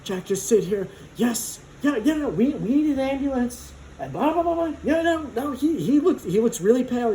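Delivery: high, caring mom voice, flustered and fussing; mother hen taking charge in a crisis; going full mom. Retold or imitated. Art teacher calling help for the fainted student, high fussing mom voice. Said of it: "she's, like, going full mom".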